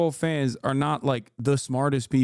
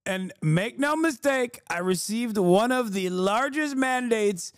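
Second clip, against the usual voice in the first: mock Trump voice; 9 semitones higher than usual. Mocking Trump speech voice.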